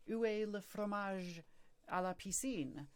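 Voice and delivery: French gibberish. Shown as a demonstration. Mimicking French near tourists in town, French gibberish. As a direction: draw out silly fake French. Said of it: "French gibberish".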